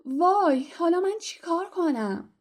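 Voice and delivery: put-on girl stranded outside the tower shrieks in dismay; shrieking panic. A narrator's reading. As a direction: wail it like she's locked out. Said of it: "هرمیون با جیغ و داد گفت".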